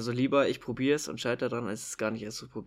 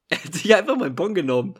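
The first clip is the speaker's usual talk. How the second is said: laughing; 5 semitones higher, 10 dB louder than usual. Real, not acted. Retelling the cheeky boy.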